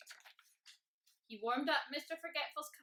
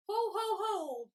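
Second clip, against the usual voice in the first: slow, loud, raised ho-ho laugh; booming laugh; 10 semitones higher, 8 dB louder, slower than usual. A narrator's reading. Tickled dragon helpless on the ground, booming laughter. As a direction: boom out a staged belly laugh. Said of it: "boomed the dragon".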